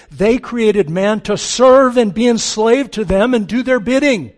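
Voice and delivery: loud, emphatic preaching that builds; forceful preaching. Real, not acted. Preacher objecting to believers being called tools, forceful. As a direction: drive it home with rising volume and force.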